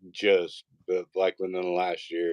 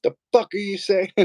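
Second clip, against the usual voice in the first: high, incredulous mock scolding; scolding wife; 12 semitones higher, 4 dB louder than usual. Wife busting in on her son, mock outrage. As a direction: imitate an outraged mom catching her kid.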